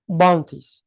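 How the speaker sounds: ordinary talk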